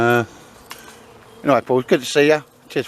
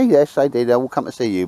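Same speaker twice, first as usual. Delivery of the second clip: ordinary talk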